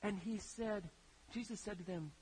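normal talking voice

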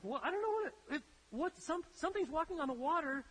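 high, frantic stammer of terrified men; panicked disciples. Acted. Frightened disciples in the storm, seeing a figure: high panicked stammer. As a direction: blurt it out like you've seen a ghost.

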